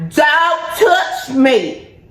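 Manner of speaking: high drawn-out shout of don't touch me; mock yell. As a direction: bark it like a warning. Mock warning to husband.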